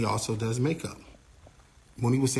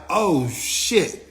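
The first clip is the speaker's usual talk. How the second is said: shocked; 5 semitones higher than usual. Retold or imitated. Seeing the girl hit James, a shocked oh shit. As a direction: gasp it out in disbelief.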